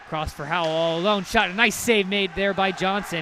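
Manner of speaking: play-by-play surging on a chance; excited save call. Real, not acted. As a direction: jump on the save with real excitement. Live call of a big save.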